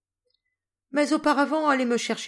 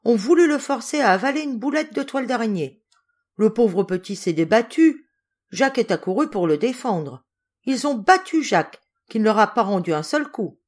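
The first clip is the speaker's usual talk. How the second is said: voix d'enfant agitée, plus haute et plus forte; récit animé; 3 semitones higher, 5 dB louder than usual. Acted. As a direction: raconte tout d'une traite, indignée et essoufflée. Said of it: "racontait avec animation". (récit animé et indigné, plus fort)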